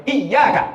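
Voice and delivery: Iyyaka with the yy doubled. Shown as a demonstration. Preacher stressing the shadda in Iyyaka. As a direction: lean on the double y. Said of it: "bukan iya".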